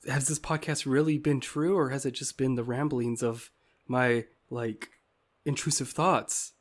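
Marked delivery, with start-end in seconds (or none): none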